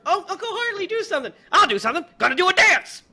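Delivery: high, loud hammed-up pleading and reply; mock pleading. Retelling the movie to friends, high hammed-up plea. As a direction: camp it up like a silly plea. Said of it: "He's like".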